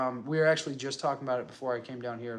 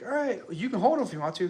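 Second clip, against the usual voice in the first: lilting, higher-pitched quote of a nurse; imitated nurse's offer; 8 semitones higher, 3 dB louder than usual. Nurse offers dad the newborn to hold, high lilting imitation.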